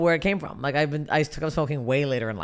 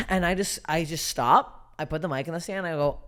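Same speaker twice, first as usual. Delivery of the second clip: ordinary talk, nothing marked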